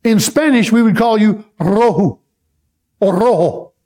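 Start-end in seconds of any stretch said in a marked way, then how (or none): 1.6-2.2 Rojo with a trilled first R; Rojo, Spanish style, R rolled. Shown as a demonstration. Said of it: "That first R has to be rolled". 3.1-3.7 Rojo with the first R trilled; Rojo in Spanish, R rolled. Shown as a demonstration. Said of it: "That first R has to be rolled".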